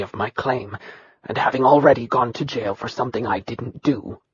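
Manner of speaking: low, soft, level voice of a bitter son; dry steady Nikirian. Acted. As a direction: hold down old hurt, flat and quiet. Nikirian explains to the Emperor.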